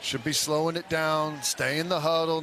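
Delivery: ordinary delivery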